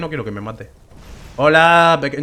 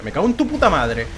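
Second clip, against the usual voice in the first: ordinary talk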